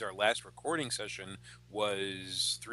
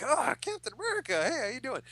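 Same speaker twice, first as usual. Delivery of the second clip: high-pitched gushing fan greeting; hero-struck; 13 semitones higher than usual. Retold or imitated. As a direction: imitate a starstruck fan meeting an idol. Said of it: "hero struck".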